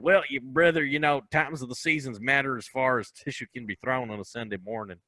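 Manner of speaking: put-on spout nonsense like a Southern good ol' boy; Southern accent. Clueless Southern guy answering what time it is. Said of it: "doing the accent".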